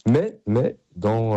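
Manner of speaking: ordinary talk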